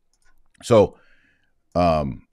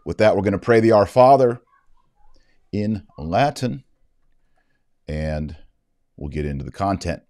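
ordinary delivery

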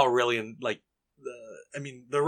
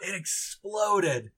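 high, very slow, drawn-out awe; hammed-up tipsy amazement; drunk, amazed Merry; 7 semitones higher, much slower than usual. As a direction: stretch it out in hushed-up wonder. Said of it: "Merry's just like".

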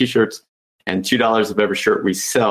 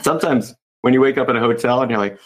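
normal talking voice

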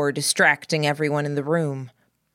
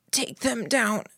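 croaked; 7 semitones higher than usual. A narrator's reading. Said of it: "I croaked".

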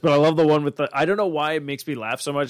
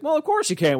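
much higher mock-breezy doctor; 13 semitones higher than usual. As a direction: toss it off like a cheery cartoon doctor. Doctor voice in retold joke.

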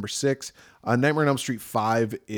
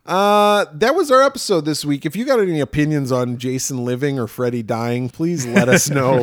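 podcast outro; 4 semitones higher, 8 dB louder than usual. Real, not acted.

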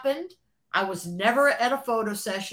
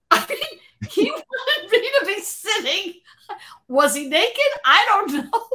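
higher, breaking into real laughter mid-sentence; laughing while talking; 11 semitones higher, 4 dB louder than usual. Real, not acted. Telling the interviewer the funny [name] story, laughing for real. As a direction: let the giggles spill through the words.

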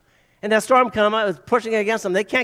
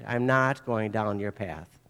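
doubting Thomas; 10 semitones lower, 7 dB quieter than usual. Retold or imitated. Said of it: "and he says".

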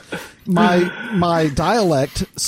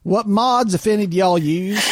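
more southern accent; 5 semitones higher than usual. Shown as a demonstration. Host redoing the question for a teasing friend, more southern accent. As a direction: play up the Southern accent on request. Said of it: "Gotta do that in a more southern accent".

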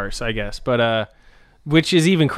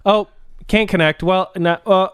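higher, halting little bursts breaking into nonsense syllables; mock frantic fumbling; 6 semitones higher than usual. Mimicking the girl who can't connect her phone, mock frantic fumbling. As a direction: imitate someone stuck fussing with a phone.

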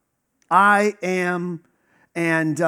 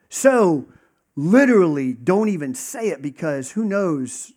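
usual speaking voice

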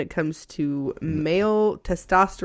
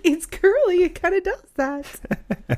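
retelling own reply; 10 semitones higher than usual. Retold or imitated. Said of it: "I'm like".

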